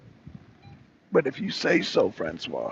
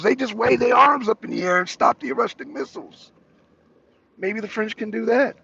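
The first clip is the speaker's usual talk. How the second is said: ordinary talk, nothing marked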